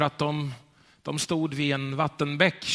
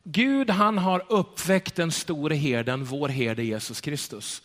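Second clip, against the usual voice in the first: slow emphasis; slower than usual. Real, not acted. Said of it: "Och det är att".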